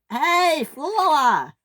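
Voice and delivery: high-pitched protesting yell; indignant call. Acted. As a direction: call out sharply at someone cutting in. James yells at Flora cutting in.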